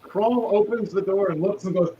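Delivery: ordinary delivery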